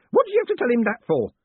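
fast, high, loud scolding; yelled. Acted. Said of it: "Groat yelled".

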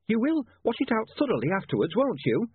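high-pitched landlady's reminder; fussy landlady. A narrator's reading. Landlady to her lodger's retreating back, fussing. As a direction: call it after him, pitched well up.

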